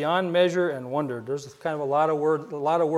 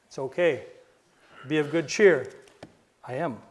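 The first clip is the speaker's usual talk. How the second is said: tender reassurance; 4 semitones lower, 4 dB quieter than usual. Acted. Jesus calling to frightened disciples.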